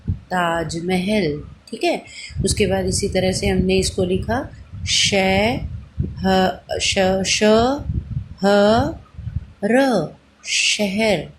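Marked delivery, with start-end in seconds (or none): 0.1-1.4 slow blended word. Shown as a demonstration. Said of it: "आप बोल के देखो". 4.9-11.3 शहर stretched very slowly after र; slow blending. Shown as a demonstration. Teacher to children, blending शहर very slowly. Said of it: "teacher sounds out and blends".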